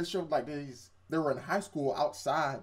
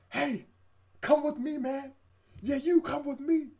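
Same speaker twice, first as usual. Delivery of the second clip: high-pitched put-on man's voice; urgent beckoning stranger; 11 semitones higher than usual. Man in lab coat summoning the main character, mock urgent. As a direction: call him over in a raised character voice.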